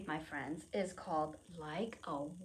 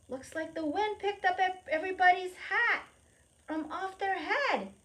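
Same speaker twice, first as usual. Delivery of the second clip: excited storytelling; 11 semitones higher, 5 dB louder than usual. Acted. Excited reading of hats blowing.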